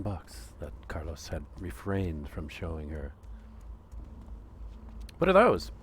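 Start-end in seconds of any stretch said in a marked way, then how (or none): 5.2-5.7 much higher, louder lady voice; curious customer. Acted. Customer spotting the hidden box, high and eager. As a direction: squeak it out, eager to know. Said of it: "she asked, tentatively".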